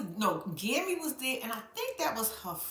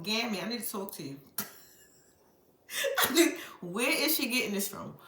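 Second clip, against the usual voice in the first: usual speaking voice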